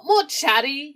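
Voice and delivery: usual speaking voice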